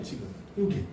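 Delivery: ordinary talk, nothing marked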